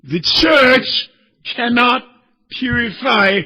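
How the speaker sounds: high, loud, cracking preacher's cry; impassioned pulpit shout; fiery preaching. Acted. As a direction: preach it out at full force. Preaching at full force.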